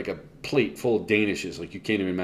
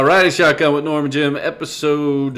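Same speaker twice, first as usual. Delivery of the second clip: show host announce; 6 semitones higher, 7 dB louder than usual. Acted.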